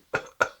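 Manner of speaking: loud hacking vampire cough into the elbow. Shown as a demonstration. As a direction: demonstrate a sharp vampire cough. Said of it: "what we call vampire cough".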